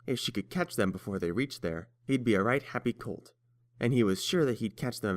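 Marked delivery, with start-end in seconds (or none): none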